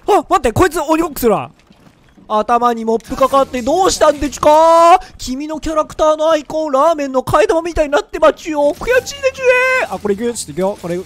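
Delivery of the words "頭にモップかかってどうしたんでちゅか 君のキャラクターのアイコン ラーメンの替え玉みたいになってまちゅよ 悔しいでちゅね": put-on higher, louder babyish taunt; mocking baby talk. Taunting the beaten opponent over voice chat, mocking baby talk. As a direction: coo at him like a baby to mock him.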